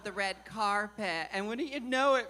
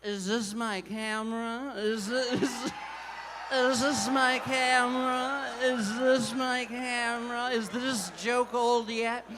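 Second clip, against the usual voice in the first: mock Tyra Banks. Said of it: "I have the opportunity to say".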